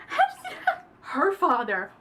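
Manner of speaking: very high, loud laughing shriek; horrified laugh. Real, not acted. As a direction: laugh through the horror.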